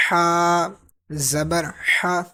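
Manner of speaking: ہا made from the middle of the throat. Shown as a demonstration. Teacher drilling the letter, ہا from mid-throat. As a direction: push a breath through the mid-throat for ہا. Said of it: "درمیان سے آواز نکالنی".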